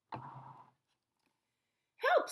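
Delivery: put-on high, breathless little child's call; a scared little boy calling out, unvoiced; small cry for help. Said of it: "said Eddie".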